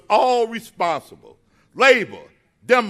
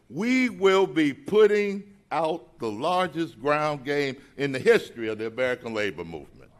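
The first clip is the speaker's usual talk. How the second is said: ordinary talk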